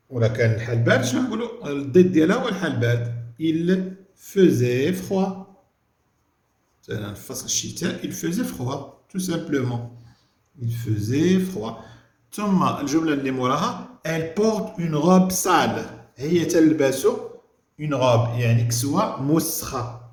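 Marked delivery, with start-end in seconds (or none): 3.4-5.5 Il faisait froid stretched very slowly; very slow, deliberate classroom dictation. Shown as a demonstration. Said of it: "dictating answer slowly". 10.6-11.7 Il faisait froid said very slowly again; slow, deliberate classroom dictation. Shown as a demonstration. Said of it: "repeats answer slowly". 14.1-15.8 elle porte une robe sale read very slowly; slow, deliberate classroom reading; slow dictation. Shown as a demonstration. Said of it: "reading sentence slowly".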